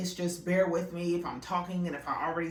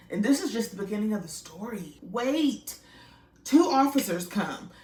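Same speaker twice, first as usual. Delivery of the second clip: ordinary talk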